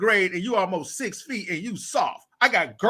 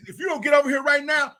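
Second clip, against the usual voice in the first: put-on raised, higher yell at a boy; stern shout; 7 semitones higher, faster than usual. Coach yelling at a boy in school, raised and stern. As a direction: bark the threat like a strict coach. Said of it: "I said".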